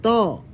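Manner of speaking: long o held in തോ; long vowel. Shown as a demonstration. Teacher links the sound to its long sign, lengthened o in തോ. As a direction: draw out the vowel of തോ. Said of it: "ശബ്ദം വരുമ്പോ ദീർഘം".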